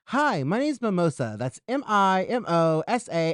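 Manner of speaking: bright-pitched, projected self-introduction; show-host intro. Acted. Host starts another take for viewers, presenter intro. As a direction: announce your name high to the audience.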